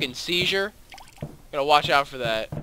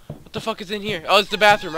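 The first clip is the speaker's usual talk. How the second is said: ordinary delivery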